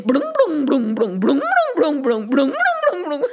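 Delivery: rolling blungs bobbing up and down in pitch; bouncing-ball noises. A narrator's reading.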